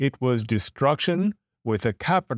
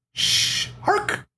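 shush and yap. A narrator's reading. Shush then puppy bark.

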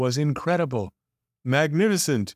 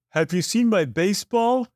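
brother yelling, a touch raised and high; mild yell; 9 semitones higher than usual. A narrator's reading. Brother yelling about a lost baseball, mildly raised. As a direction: call it out across the house. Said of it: "his brother yelled".

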